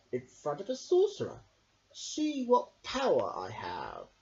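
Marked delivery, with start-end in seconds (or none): 1.9-4.1 slow boast. A narrator's reading. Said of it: "said Sondrak".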